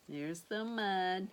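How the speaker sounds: ordinary delivery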